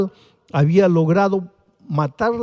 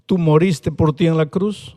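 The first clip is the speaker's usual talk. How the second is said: ordinary talk, nothing marked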